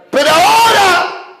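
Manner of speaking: high, drawn-out shouted commission; full-force preaching. Retold or imitated. The Lord charging Peter to feed his sheep, full-force preaching. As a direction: thunder the command as the preacher voicing the Lord.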